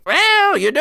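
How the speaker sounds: high pinched-nose nasal voice; nasal Fresk voice. Acted. GM voicing the bumbling deputy, nasal and pinched. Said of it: "He was nasally".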